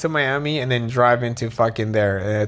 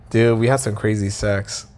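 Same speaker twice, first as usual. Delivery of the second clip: ordinary talk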